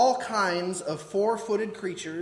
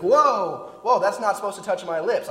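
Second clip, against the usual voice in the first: startled recoil; 2 semitones higher, 8 dB louder than usual. Retold or imitated. Imagined believer recoiling.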